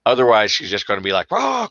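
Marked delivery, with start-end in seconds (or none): 1.3-1.7 high-pitched excited dog outburst; over-excited dog. Acted. Dog squirming in owner's arms, high-pitched and worked up. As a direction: imitate a dog straining to get loose, high-pitched.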